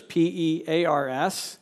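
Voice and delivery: P E A R S spelled letter by letter. Shown as a demonstration. Spelling the kids' pun for the congregation, P E A R S letter by letter. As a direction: spell out P E A R S, one letter at a time. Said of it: "came onto in pairs".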